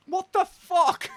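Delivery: slow, high disbelieving what the fuck; drawn-out high-pitched disbelief; stunned. Real, not acted. Reacting to a cringe pickup line, stunned and high.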